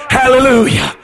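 normal talking voice

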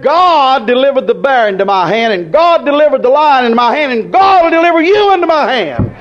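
David defying the giant, rising to full voice; triumphant building cry. Retold or imitated. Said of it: "He said".

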